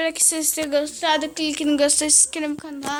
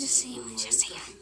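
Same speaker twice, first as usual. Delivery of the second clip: soft hushed whisper; 12 dB quieter than usual. Real, not acted. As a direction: whisper it, sneaking past. Whispering while sneaking.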